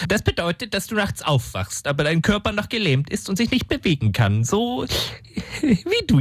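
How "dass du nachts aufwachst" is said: ordinary talk, nothing marked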